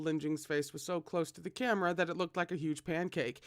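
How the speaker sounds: ordinary delivery, nothing marked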